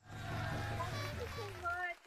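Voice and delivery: high, drawn-out gushing thank-you; excited thanks. Real, not acted. Thanking viewer for a gift. (tuwang-tuwang pasasalamat, mataas at pahaba)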